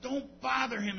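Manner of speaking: a sharp, loud shush, then a scolding; hushing the beggar. Retold or imitated. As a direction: shush him hard to keep him away from Jesus. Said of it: "They said".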